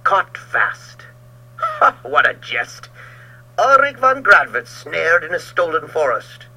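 loud, mocking, savage taunt; gloating cry. Georg taunting Ulrich trapped beside him, loud gloating cry. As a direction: jeer at your trapped enemy. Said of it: "he cried".

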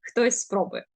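ordinary talk

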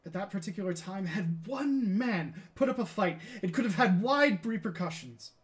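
measured start swelling to loud declamation; Galileo, regretful and impassioned. Acted. Galileo on his unique opportunity, increasingly impassioned. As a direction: build the lost chance into a stage outcry.

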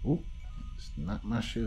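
ordinary delivery

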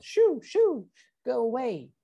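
very high shooing calls; yelled. A narrator's reading. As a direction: shoo it off in a high-pitched cry. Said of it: "everyone yelled".

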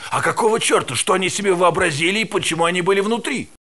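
high, heated demand; indignant outburst. Acted. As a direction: demand answers, outraged. Scientist demanding answers.

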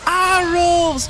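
high, slow outraged complaint; outraged disbelief. Real, not acted. Gamer fuming as enemy dodges arrows, outraged. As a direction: drag it out in disbelief.

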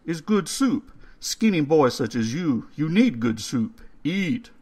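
put-on coaxing old man spooning soup. A narrator's reading. Old man's voice, held.

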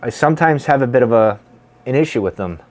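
talking around pipe stem; speaking between pipe puffs; pipe between lips at times. Real, not acted. Seen 0: pipe mostly held in hand, brought to lips between phrases.

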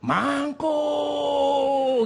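high, drawn-out holler; shouted call. Retold or imitated. Preacher re-enacts lady shouting at him, long high holler. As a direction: stretch it out in a high mock yell.

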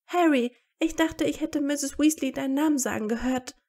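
high, excited mock shriek; narrated shriek, pitched well up. A narrator's reading. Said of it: "kreischte sie".

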